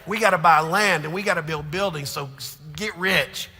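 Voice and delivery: loud, high, choked-up pleading; tearful shout. Retold or imitated. Pastor reliving telling his friend to stay, tearful loud plea. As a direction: cry it out loud with a laugh at the end. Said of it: "Man, I'm crying".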